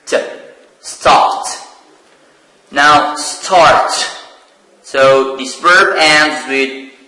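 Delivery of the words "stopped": "Stopped" ending in a crisp t, one syllable. Shown as a demonstration. Teacher showing students how the ending of "Stopped" sounds. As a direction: clip "Stopped" so it ends on t. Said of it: "the pronunciation is stopped".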